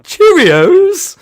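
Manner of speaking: very high, loud squeal; hammed-up high British shout; mock British punchline. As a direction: shriek the punchline in a silly British voice. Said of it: "British person's favourite cereal".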